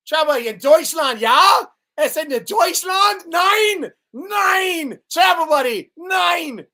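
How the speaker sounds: loud, high hammed-up German shouting; jokey fake German yelling; mock German shout. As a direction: bellow it like a cartoon German officer.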